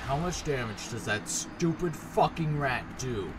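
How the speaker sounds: slow, deliberate exasperated question; slow exasperation. Real, not acted. Player complaining about the stupid rat's damage, slow exasperation. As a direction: spell out the grievance slowly. Restates outburst with restraint.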